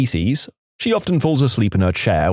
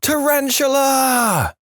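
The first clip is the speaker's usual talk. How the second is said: high, drawn-out mock scream; much slower than usual. A narrator's reading. Wife waking up in bed, mock screaming. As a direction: scream it high and long, without full volume. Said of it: "and screaming".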